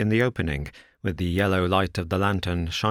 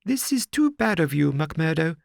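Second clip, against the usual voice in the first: higher, helpless complaining gentleman; perplexed Thaddeus; 8 semitones higher than usual. A narrator's reading. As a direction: fret like a flustered little man. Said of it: "perplexed and helpless manner".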